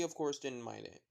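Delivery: ordinary delivery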